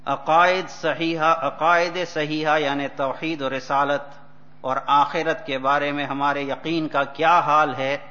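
rapid, loud recitation of Urdu text; fast Urdu reading. Acted. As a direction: read the Urdu passage quickly and forcefully. Reads Urdu text in sermon.

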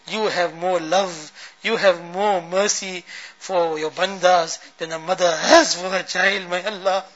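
ordinary talk, nothing marked

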